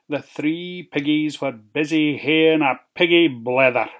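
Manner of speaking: usual speaking voice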